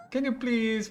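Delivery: put-on high-pitched child's plea; acted pleading. Daughter to mother about the cat, pleading. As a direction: beg in a high voice.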